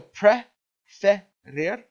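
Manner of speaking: teacher slowly sounding out a word; slow syllable demo. Shown as a demonstration. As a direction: spell out "preferir" slowly by its syllables. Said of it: "Let's take a look at the word preferir".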